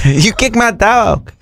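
movie quote impression. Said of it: "Baby Joker".

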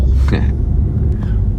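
gentle amused chuckle. Real, not acted. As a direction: laugh fondly under the breath. Laugh at child.

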